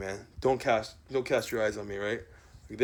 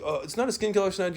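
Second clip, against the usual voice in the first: mock protest pitched much higher; higher, whiny put-on protest; mocking quote; 6 semitones higher, faster than usual. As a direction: parody the excuse. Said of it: "you're like".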